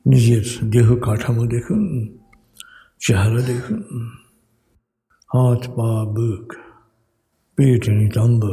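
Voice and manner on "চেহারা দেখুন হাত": ordinary delivery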